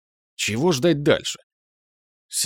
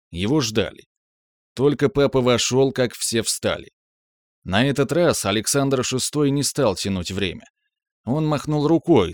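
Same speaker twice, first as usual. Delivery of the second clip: usual speaking voice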